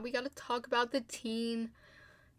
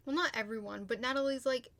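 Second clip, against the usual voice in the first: ordinary talk, nothing marked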